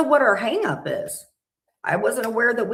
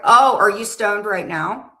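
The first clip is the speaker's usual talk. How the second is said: louder, drawn-out amused question; loud teasing surprise; 8 dB louder, slower than usual. Real, not acted. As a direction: call her out playfully. Teasing the other streamer.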